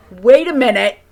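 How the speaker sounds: loud laughing wait a minute; surprised realization. Retold or imitated. Vlogger recalling the hammer video, laughing surprise. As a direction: stop short in amused disbelief. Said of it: "I'm like".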